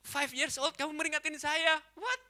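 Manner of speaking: high, loud indignant disbelief; mock offended. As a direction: protest as if your pride is hurt. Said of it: "Tersinggung saya gitu".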